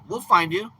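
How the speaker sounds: loud teasing warning, "find" rising; mock threat. Retorting to the dodger.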